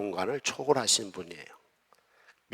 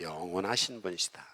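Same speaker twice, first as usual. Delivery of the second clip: soft, low echo of the answer; hushed call-and-response reply; quiet answer; 4 semitones lower, 8 dB quieter than usual. Shown as a demonstration. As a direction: answer back softly, as if prompting the congregation. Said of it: "하나님은 어떤 분이시다?".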